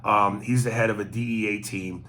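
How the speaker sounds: ordinary delivery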